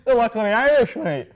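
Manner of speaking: loud, high Irish caricature; mock Irish accent. Shown as a demonstration. Guest demonstrating Irish, loud mock Irish accent. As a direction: call it out as a cartoon Irishman. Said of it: "what do these people sound like?".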